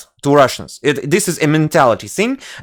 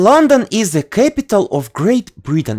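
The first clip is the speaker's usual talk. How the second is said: higher, pupil-like Russian English recital; Russian school English; 4 semitones higher than usual. Shown as a demonstration. Showing how kids speak, Russian school English. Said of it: "kids are speaking like this".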